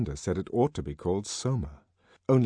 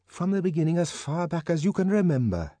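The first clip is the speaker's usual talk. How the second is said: urging; 8 semitones higher than usual. A narrator's reading. Bernard urging John to tell all.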